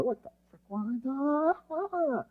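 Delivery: high-pitched, held-back mock freak-out; mock emotional outburst. Leader imitating his own emotional outburst to the room, mock-frantic. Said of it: "I get emotional".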